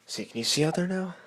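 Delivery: high, loud and quick; calling out over the wind; loud excited call. Real, not acted.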